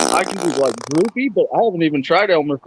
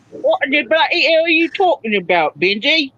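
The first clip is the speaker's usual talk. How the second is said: put-on Australian accent; 9 semitones higher than usual. Australian accent demo after accent talk.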